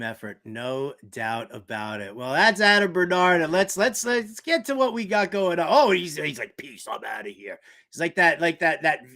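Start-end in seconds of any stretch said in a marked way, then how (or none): none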